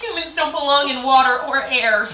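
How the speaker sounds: loud, high mock protest; picky boyfriend's rant. Boyfriend ranting about swimming and flying, mock indignant. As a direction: declare it loudly, on the edge of laughing. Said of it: "And, like".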